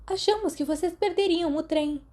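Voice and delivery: a high, bright exclamation of relief; exclaiming. Acted. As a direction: greet them with a lively cry. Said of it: "Marlene exclamou".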